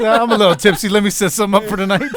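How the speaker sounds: high, loud giggly tipsy voice; giggly drunk. Retold or imitated. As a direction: laugh through it, a little tipsy.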